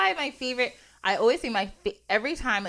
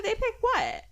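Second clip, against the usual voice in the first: higher-pitched baffled question; incredulous; 8 semitones higher, slower than usual. Retold or imitated. Retelling a council discussion to a friend, incredulous. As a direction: act out being taken aback. Acting out her baffled reaction.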